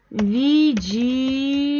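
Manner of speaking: widzimy said slowly, stretching the ending -my; slow drawn-out ending. Shown as a demonstration. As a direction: draw out widzimy so the ending sounds clear. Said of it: "y terminación". (alargar despacio la terminación de widzimy)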